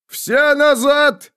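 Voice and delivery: very high, long drawn-out yell; urgent shout. A narrator's reading. Mage warns the troops to fall back, drawn-out high shout. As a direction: yell the warning slowly to everyone. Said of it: "крикнул пришедший в себя маг".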